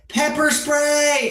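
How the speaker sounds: shouted. Acted. Yelling pepper spray.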